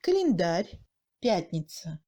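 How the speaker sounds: "календарь, пятница" with A as mute e; strongly reduced A. Shown as a demonstration. Teacher showing second-degree reduction, "календарь, пятница" with faint А. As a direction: swallow the unstressed А in "календарь, пятница". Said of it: "il ressemble à un E muet".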